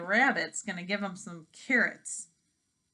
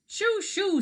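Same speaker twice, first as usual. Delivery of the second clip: high shooing; 11 semitones higher, 4 dB louder than usual. Acted. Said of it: "said the chickens".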